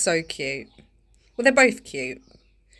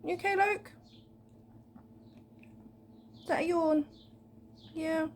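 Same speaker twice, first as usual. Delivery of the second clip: much higher cooing pet talk; talking to a dog; 10 semitones higher, 5 dB quieter than usual. Real, not acted. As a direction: coo softly at the pet.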